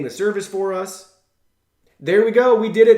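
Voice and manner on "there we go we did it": excited. Real, not acted. Phone activation finally completes.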